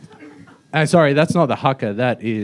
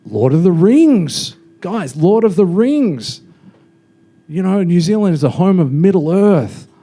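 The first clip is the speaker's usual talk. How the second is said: mock patriotic boasting; 6 semitones higher, 5 dB louder than usual. New Zealander boasting to Australians about Middle Earth, mock proud. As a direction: hype it up like an obsessed fan. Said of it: "a lot of the time it's like".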